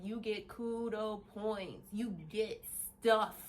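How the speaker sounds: slow, spaced-out promise; deliberate, drawn-out delivery; emphatic repeat. Retold or imitated. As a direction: stretch each word out for emphasis.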